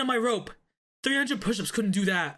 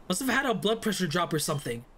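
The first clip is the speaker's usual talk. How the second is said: ordinary talk, nothing marked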